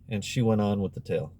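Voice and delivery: ordinary delivery, nothing marked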